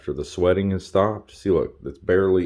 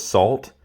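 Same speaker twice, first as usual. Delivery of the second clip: "Salt" punched higher and louder, rising; emphatic salt; 5 semitones higher, 4 dB louder than usual. Real, not acted. Cook stressing to viewers to use salt, punched high. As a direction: hammer the word "Salt" home. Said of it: "I don't know how much more I gotta emphasize this".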